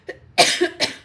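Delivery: real throaty cough. Real, not acted. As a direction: cough hard mid-sentence. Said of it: "Now my eyes are watering".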